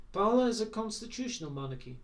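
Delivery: measured, weighty statement; grave royal correction. A narrator's reading. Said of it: "Morgan answered gravely".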